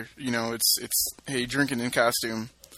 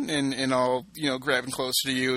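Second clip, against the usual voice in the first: ordinary talk, nothing marked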